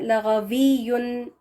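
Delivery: लगवियुन said very slowly and clearly; slow demo. Shown as a demonstration. Teacher answering how to read it, slowly. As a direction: draw out लगवियुन syllable by syllable. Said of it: "लेकिन इसको पढ़ा कैसे जाता है".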